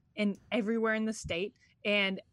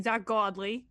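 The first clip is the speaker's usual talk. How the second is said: Brooklyn accent; slower than usual. Real, not acted. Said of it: "That's a New York accent".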